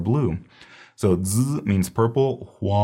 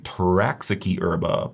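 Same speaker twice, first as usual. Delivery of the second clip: ordinary talk, nothing marked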